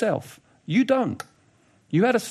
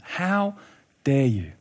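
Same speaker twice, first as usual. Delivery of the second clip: restrained indignation, a pause before the falling end; utterly indignant; 4 semitones lower, much slower than usual. Retold or imitated. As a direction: deliver a measured, affronted rebuke. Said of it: "Utterly indignant".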